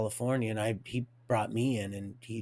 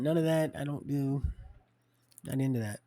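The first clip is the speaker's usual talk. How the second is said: ordinary delivery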